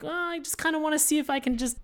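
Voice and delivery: high, quick put-on voice; squeaky little wheedling voice; meddling somebody. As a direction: imitate someone itching to poke at it. Voicing someone fiddling with the dome.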